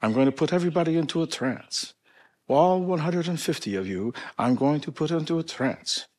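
put-on grand showman with a Polish accent. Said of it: "this is my Polish accent".

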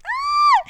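sudden high hiccup yelp; a loud squeaky hiccup. Real, not acted. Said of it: "Ya me escucharon tener hipo".

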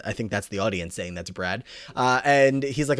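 ordinary delivery

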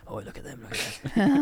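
acted cow-spotting. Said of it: "tap him up from the shoulder and go".

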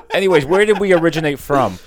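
usual speaking voice

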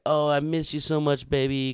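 high, gushing mock sweetness; mock lovey welcome. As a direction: ham up a girlfriend missing you. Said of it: "You're supposed to be like".

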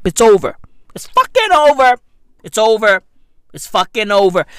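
loud, emphatic ranting declaration. Real, not acted. Vlogger ranting to viewers, loud and emphatic. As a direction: hammer home that it's over.

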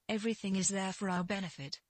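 usual speaking voice

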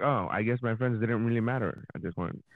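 deflated. Retold or imitated. Retells being blown out of contest.

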